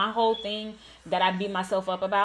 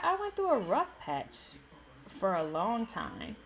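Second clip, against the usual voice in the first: ordinary talk, nothing marked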